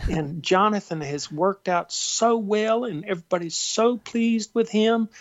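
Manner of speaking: high Southern gushing; Junie's Southern accent. Retold or imitated. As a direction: gush in a Southern accent, pitched well up. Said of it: "she has this wonderful Southern accent".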